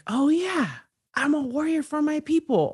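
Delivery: high, rallying quoted voice; sudden proud discovery, acted out; fired-up realization. People reminded they are warriors, fired-up mock realization.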